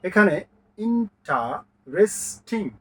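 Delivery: Interesting split slowly into four beats; syllables counted out. Shown as a demonstration. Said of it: "এক, দুই, তিন, চার". (Interesting চার ভাগে ধীরে)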